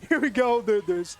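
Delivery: loud, high, real laughter through the words; laughing. Real, not acted. Caster laughing off a [name] slip, high and loud. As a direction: try to move on while cracking up.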